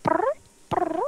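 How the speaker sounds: high rolled brr like a cat; cat trill imitation. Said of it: "she's like".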